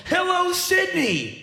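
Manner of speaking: high, drawn-out arena hello; rock star greeting. Pretend. Comedian mocking a rock-star greeting to his small audience. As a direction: greet the crowd like a stadium star. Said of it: "if I went".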